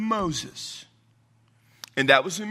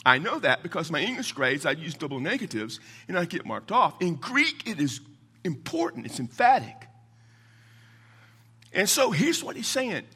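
usual speaking voice

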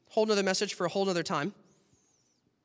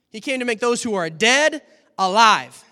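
usual speaking voice

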